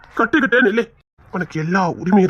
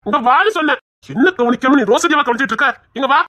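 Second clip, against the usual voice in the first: raised, higher voice calling her over; 6 semitones higher, 4 dB louder than usual. Acted. Husband calling his wife Rani over, raised voice. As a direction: call her over, half annoyed.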